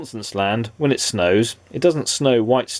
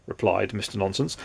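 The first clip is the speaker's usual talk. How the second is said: usual speaking voice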